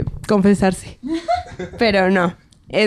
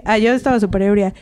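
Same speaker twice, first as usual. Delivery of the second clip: normal talking voice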